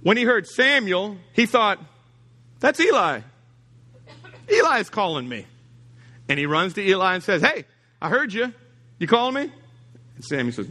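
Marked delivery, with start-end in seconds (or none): 2.6-5.5 put-on higher, eager boy's voice; young Samuel. Young Samuel telling himself Eli is calling, eager. 7.4-9.5 put-on higher, questioning boy's voice; a boy eagerly asking; eager young Samuel. Young Samuel running to Eli asking, eager.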